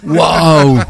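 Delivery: wow with a laugh; impressed, laughing exclamation; amazed wow. Real, not acted. Réaction à la pointure. (émerveillé)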